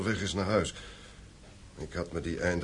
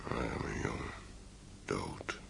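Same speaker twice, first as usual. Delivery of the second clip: low, hollow whisper of grief, much softer; grieving whisper; 10 semitones lower, 14 dB quieter, much slower than usual. Acted. Father mourning the son who died upstairs, grieving whisper. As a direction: breathe the words out in disbelief.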